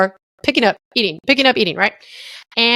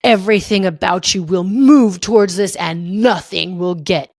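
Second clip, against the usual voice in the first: much lower and slow, a grave heavenly pronouncement; God's voice; 4 semitones lower, slower than usual. Retold or imitated. God telling Mitch his purpose, low and slow. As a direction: speak as God giving a life purpose. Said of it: "what's up, God?".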